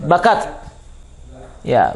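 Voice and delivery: ordinary delivery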